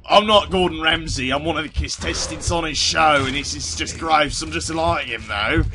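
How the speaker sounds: hammed-up Cockney contestant; badly faked British accent, Gordon Ramsay style; terrible British accent. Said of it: "my terrible, uh, British accent".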